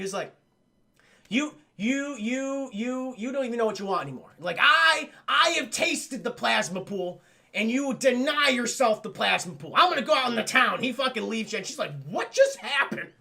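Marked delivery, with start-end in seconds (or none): 1.3-10.8 high-pitched, indignant rant; freaking out. Retold or imitated. Man denouncing her over the plasma pool, acted freak-out. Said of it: "He's just freaking out". 12.1-13.1 high, slow, baffled question; retold stunned disbelief; taken aback. Woman left behind after his outburst, acted bewilderment. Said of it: "she's taken aback by this".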